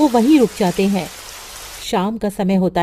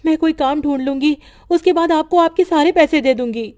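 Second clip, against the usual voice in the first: higher, begging for more time; pleading widow; 5 semitones higher, 3 dB louder than usual. Acted. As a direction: ask the landlord humbly for mercy. Widow begs landlord for time.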